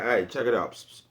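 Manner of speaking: ordinary talk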